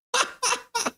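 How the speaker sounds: sudden squealing laugh, way up high; high cackling laugh. Real, not acted. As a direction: burst into a shrieking giggle. Laughing at chat's joke.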